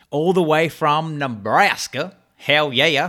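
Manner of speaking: lightly hammed-up American drawn out on Nebraska; mock American accent. Host introducing a guest from Nebraska, mock American accent. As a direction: put on a playful American twang.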